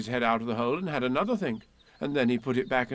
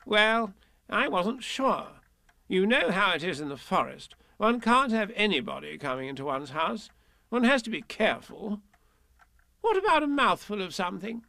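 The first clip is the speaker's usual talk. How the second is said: fussy, higher Rabbit voice, careful and polite; Rabbit's voice; 8 semitones higher than usual. Acted. Rabbit greets Pooh at home, fussy higher voice. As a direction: welcome the guest politely.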